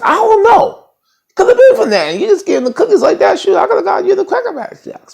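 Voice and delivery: very high, squeaky rant, loud then easing; mock incredulous falsetto. Real, not acted. Ranting about Charity and a Cracker Jack ring, falsetto disbelief. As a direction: rattle it off in exaggerated falsetto.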